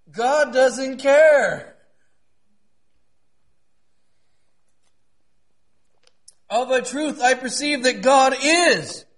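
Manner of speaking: ringing pulpit proclamation; impassioned preaching. Real, not acted. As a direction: preach it high with drawn-out emphasis. Preaches at full force.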